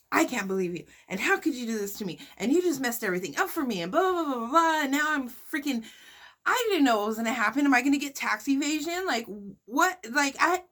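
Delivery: a retold panicked message that keeps climbing; frantic retold rant. Ranting at her in DMs.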